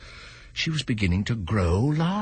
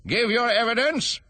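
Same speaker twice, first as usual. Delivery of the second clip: high, mildly angry order; king losing patience, lightly hammed; 4 dB louder than usual. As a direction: repeat the order with mock anger. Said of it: "the king repeated angrily".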